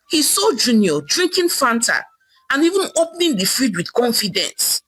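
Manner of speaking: ordinary delivery, nothing marked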